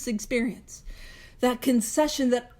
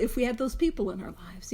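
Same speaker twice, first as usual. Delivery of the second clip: usual speaking voice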